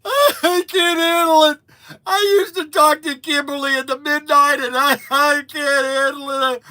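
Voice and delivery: high, loud imitated wailing; mock bawling. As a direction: bawl it out in an exaggerated cry. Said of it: "and he's bawling".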